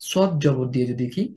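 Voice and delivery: ordinary talk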